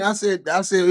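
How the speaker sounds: normal talking voice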